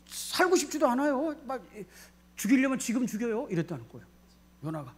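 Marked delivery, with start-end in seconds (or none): 0.1-3.5 imitated outburst, pitched well above normal; acted exasperated protest. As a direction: protest to God in a raised, fed-up voice. Jonah's angry complaint to God acted out.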